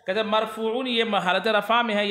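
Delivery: usual speaking voice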